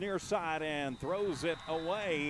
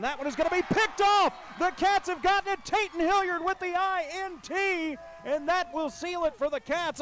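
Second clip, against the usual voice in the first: excited; 12 semitones higher, 8 dB louder than usual. Real, not acted. Interception call in play-by-play.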